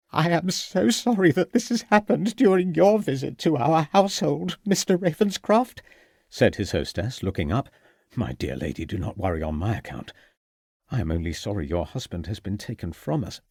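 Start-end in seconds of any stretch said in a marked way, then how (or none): 0.1-5.8 higher, upset lady apologizing to her guest; a shaken hostess, voice lifted with worry; distressed hostess. A narrator's reading. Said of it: "a distressed Missus Pitzer". 8.1-13.4 lower, softer gentleman consoling a widow; gentle reassurance. A narrator's reading.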